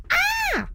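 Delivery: sudden very high-pitched "aah"; all-capitals "Ah!". Acted. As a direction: squeal it out as if written in capitals. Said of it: "the use of all capitals".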